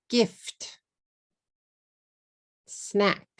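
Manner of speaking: "And, but, is, frog, gift, snack" each in one beat; isolated English words, no added vowels; one syllable each. Shown as a demonstration. Teacher giving words to repeat after, one syllable each. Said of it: "Make sure you only say one syllable".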